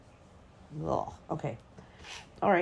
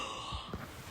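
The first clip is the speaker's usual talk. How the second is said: breathy gasp of disgust; real gasp at something gross; horrified gasp; 13 dB quieter than usual. Real, not acted. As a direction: suck in air, appalled. Horrified by body in water.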